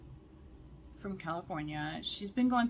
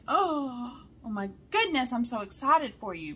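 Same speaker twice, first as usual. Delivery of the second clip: higher and louder, gushing; excited reply; 4 semitones higher, 6 dB louder than usual. Retold or imitated. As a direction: replay your thrilled reaction. Retelling her own excited reply.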